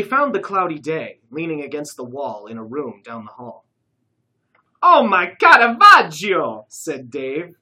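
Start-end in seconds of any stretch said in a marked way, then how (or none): 4.8-6.7 high, loud, slow gasp of awe; awed exclamation. A narrator's reading. Dave finding the stolen painting, awed exclamation. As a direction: marvel at the masterpiece. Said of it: "tenderly running his fingers".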